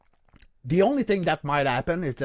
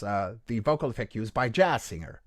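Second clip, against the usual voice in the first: ordinary delivery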